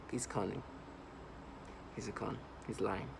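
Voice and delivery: low, hushed mock-analytical verdicts; voice of logic. Mimicking perps dismissing the speaker, in a quiet mock-logical voice. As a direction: murmur it like a detached analyst's conclusion. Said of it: "By using a voice of logic, of analyticalness".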